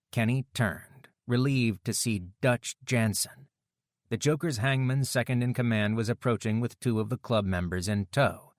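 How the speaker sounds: plain reading voice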